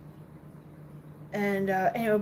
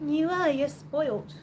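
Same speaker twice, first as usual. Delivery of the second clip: pet talk; 5 semitones higher than usual. Real, not acted. Telling her cat it is spoiled, cooing pet talk. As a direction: coo it at the cat.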